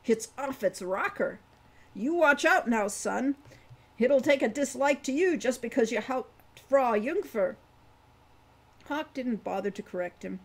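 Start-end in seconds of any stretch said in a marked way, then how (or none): 0.1-7.6 put-on higher, louder voice for a rambling old dwarf. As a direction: play the chatty old dwarf, a bit louder. Wolfgang warns Hawk.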